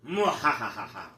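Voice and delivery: low evil villain cackle; evil laugh. Acted. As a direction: cackle like a dark lord. Villain introduces himself.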